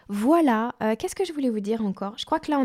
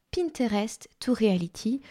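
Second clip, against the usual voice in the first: English words in a French accent; Franglais. Shown as a demonstration. Repeating her masterclass title, plain Franglais accent. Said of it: "en franglais".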